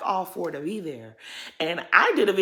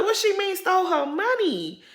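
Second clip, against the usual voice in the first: shocked disbelief; 12 semitones higher than usual. Retold or imitated. Vlogger reacting to the reality show reveal, shocked high disbelief. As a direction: voice jumps way higher in stunned disbelief. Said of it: "but I was very much shooketh".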